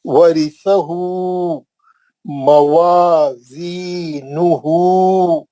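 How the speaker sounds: slow, drawn-out Quranic recitation. Shown as a demonstration. Teacher recites word slowly for class. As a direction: chant each syllable slowly for students. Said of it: "मैं पूछा आपको कितने item है".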